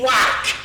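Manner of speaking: a sharp high-pitched whack, like a swing landing; high mock whack. Telling the church about the blindfolded girl, high mock whack. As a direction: sell the hit with a high playful whack.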